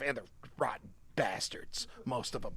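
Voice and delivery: secretive whispered jab at lawyers; whispered aside. Real, not acted.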